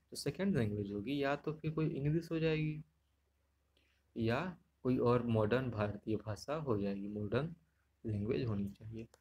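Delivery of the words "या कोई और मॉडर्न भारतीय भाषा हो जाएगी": usual speaking voice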